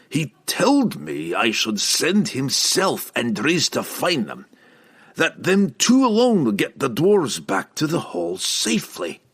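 a hint of stubborn dwarf; recollecting dwarf. A narrator's reading. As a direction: insist as the memory returns. Dwarf forcing memory, in character.

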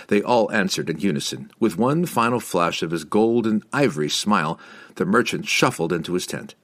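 ordinary delivery, nothing marked

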